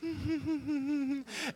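high, soft mock titter; coy giggle. Imitating sister-in-law batting her eyes, soft coy giggle. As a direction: titter while playing coy. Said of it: "she'll bat her eyes".

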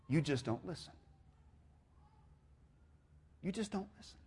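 very soft, sobering refrain; hushed quotation. Acted. Preacher quoting Joan of Arc's answer, hushed and sobering. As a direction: let each repeat sink almost away. Quoted play line repeated softly.

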